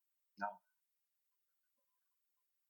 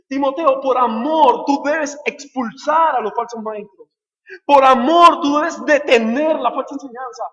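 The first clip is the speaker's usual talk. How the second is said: loud, high, impassioned sermon; fervent preacher's exhortation at full voice; full-force preaching; 10 semitones higher, 16 dB louder than usual. Real, not acted. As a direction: build a driving pulpit refrain, punching each "por amor".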